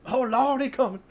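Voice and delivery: high, loud comic holler; mock alarmed yell. Game master jokingly yells that something is coming, mock alarmed yell.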